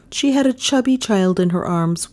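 plain reading voice

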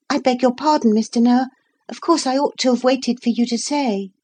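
put-on high, polite little girl's apology; a girl apologising to Mister Noah for interrupting; apologetic girl. A narrator's reading. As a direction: say sorry like a well-mannered child. Narrator voicing Lucy.

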